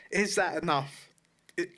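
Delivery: usual speaking voice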